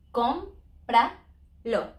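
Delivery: cómpralo very slowly, stress on CÓM; slow teaching cómpralo, stress up front; stress on first syllable. Shown as a demonstration. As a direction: stretch out CÓM-pra-lo. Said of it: "we do it in co".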